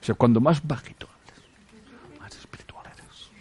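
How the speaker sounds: mocking whisper, breathy and hushed; mock spiritual whisper. Mocking the spiritual ego to his audience, in a hushed whisper. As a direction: whisper it like a pious poser. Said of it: "hay que hablar bajito".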